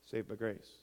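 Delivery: low, soft throwaway; de-emphasized afterthought. Shown as a demonstration. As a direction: drop it right down like a footnote. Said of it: "Emphasis on the sinner".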